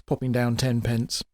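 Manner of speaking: plain reading voice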